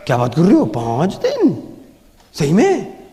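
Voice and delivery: a hint of an incredulous recruit; mock disbelief. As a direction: lightly play a doubter scoffing at five nights. Mocking shocked audience member.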